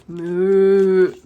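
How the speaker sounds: loud long cow moo. Acted. Cows in the field moo at the van, loud. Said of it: "cried the cows".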